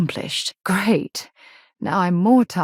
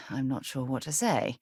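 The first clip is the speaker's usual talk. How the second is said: normal talking voice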